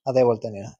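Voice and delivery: ordinary talk, nothing marked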